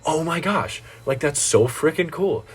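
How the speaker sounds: lightly mocked excited fan; mock gushing. Retelling impressed girl's gushing reaction.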